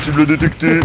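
flat robotic readout; toy robot voice. Pretend. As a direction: repeat it like a robot. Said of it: "je suis un robot".

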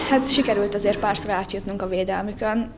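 ordinary talk

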